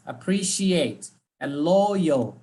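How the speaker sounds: "Appreciate" and "loyal" slowly, in separated halves; words cut in two. Shown as a demonstration. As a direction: stretch "Appreciate" and "loyal", each in two parts. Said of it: "cut the words in two".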